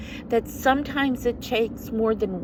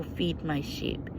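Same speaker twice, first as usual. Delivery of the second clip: soft, realizing tone; 3 semitones lower, 8 dB quieter than usual. Shown as a demonstration. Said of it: "he doesn't just mean in the physical".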